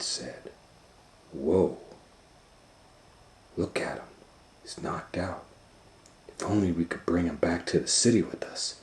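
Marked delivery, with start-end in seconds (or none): 1.3-8.7 softer, hushed amazement; hushed awe. Acted. Doctor Varmint marveling at the knocked-out cat, hushed. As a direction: whisper over the sleeping giant.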